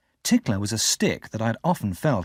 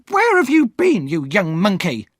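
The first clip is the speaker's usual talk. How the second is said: shrill-pitched furious scolding, much higher and louder; angry shout; 9 semitones higher, 6 dB louder than usual. Acted. Sister scolding Pip for being late, furious shout. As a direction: scold the boy at full volume. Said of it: "Missus Joe cried".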